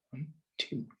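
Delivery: whispered counting of a pair; softly counting. Shown as a demonstration. Teacher whispering to children how a pair works. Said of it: "teacher explains pair softly".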